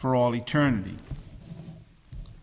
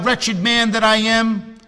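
raised and slow, a pleading cry; higher, drawn-out lament to God; 6 semitones higher, 4 dB louder than usual. Retold or imitated. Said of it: "he cried out".

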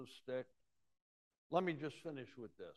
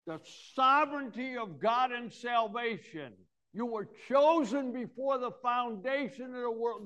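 louder, higher, driving preacher cadence; full-force preaching; 5 semitones higher, 8 dB louder than usual. Acted. Preacher to the congregation on God's choosing, loud and rising. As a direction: build it up and hammer the point home.

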